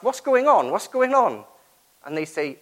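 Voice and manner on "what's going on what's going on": high, loud, urgent question; urgent call out. Retold or imitated. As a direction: call out to find out what's happening. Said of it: "he sort of asks".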